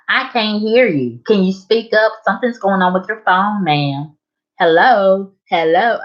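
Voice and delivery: high, loud mock dispatcher voice calling out. Woman mimics the 911 dispatcher asking her to speak up, in a high mock voice. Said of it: "And here she is".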